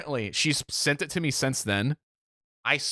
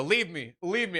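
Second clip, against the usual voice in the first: higher, fading martyr's plea; mock resignation to death; 4 semitones higher than usual. Injured man telling family to abandon him, hammed-up dying plea. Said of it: "I'm accepting death".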